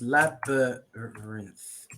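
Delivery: "labyrinth" drawn out very slowly, syllable by syllable; slow model pronunciation. Shown as a demonstration. Teacher shows student, slow syllable-by-syllable "labyrinth". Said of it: "It's like this".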